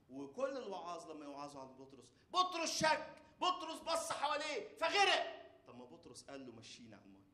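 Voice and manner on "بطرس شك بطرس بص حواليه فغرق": preaching at full force. Acted.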